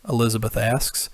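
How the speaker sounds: usual speaking voice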